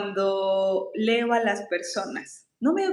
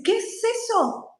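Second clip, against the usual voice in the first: high, incredulous ¿Qué es eso? with the stress on qué; baffled question; 12 semitones higher than usual. Retold or imitated. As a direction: play him totally lost by the idea. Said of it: "me dice". (imitando su desconcierto, mucho más agudo)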